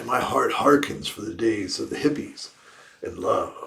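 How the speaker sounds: ordinary delivery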